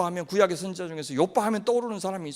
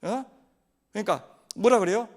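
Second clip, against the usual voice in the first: ordinary delivery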